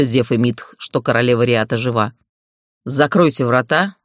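ordinary delivery, nothing marked

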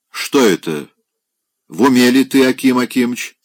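строгий окрик, громче; одёргивает гостя; строго. Acted. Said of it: "строго сказала она". (бабушка строго одёргивает пьяного Опёнкина)

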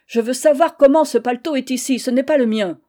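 loud, angry demand; husband angrily demanding whose coat it is; getting angry. Acted. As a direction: insist hard, losing patience. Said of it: "il se fâchait".

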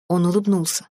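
ordinary delivery, nothing marked

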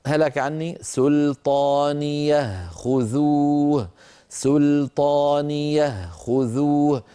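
slow melodic tajweed chant; Quran recitation. Acted. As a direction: recite the verse slowly and tunefully.

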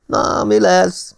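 high, slow drawn-out call. A narrator's reading. Ellen calls to the narrator from the veranda, high and drawn out. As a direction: stretch the call out across the veranda. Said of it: "kiáltotta felém Ellen".

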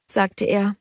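ordinary delivery

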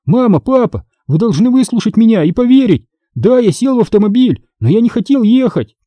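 high, urgent pleading; crying out. A narrator's reading. Boy begging parents to believe him, urgent cry. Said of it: "вскричал я".

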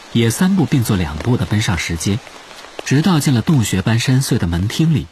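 plain reading voice

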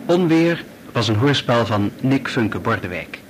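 normal talking voice

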